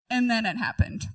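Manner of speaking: ordinary talk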